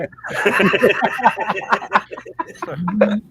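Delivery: high laughter; laughing. Real, not acted. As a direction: burst out laughing. Laughing at impression.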